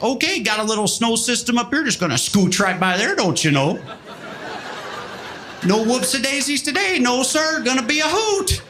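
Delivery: chirpy Midwestern pilot on the intercom; lightly done Midwest accent. Retold or imitated. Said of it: "the pilot comes on".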